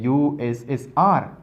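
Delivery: "यूएसएसआर" spelled out as separate letters. Shown as a demonstration. Teacher explaining abbreviations, "यूएसएसआर" pronounced letter by letter. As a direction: give each letter of "यूएसएसआर" its own beat. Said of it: "अलहदा हर एक letter को pronounce करते हैं".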